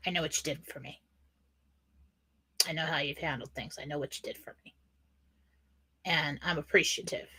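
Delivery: soft, subdued message with pauses; emotional, held back. Real, not acted. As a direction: hold the emotion back, speaking softly. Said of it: "it's kind of emotional right now".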